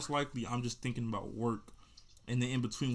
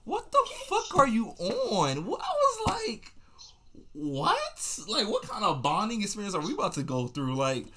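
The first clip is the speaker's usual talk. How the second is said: much higher and louder, slow outraged disbelief; shrieking disbelief; 10 semitones higher, 7 dB louder, slower than usual. Retold or imitated. Retelling his reply to the girl's question, loud high shocked outrage. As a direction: hit the roof in mock outrage. Reacting to being asked if touched.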